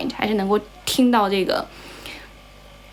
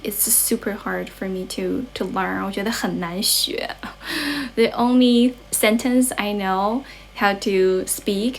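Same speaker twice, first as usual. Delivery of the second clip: normal talking voice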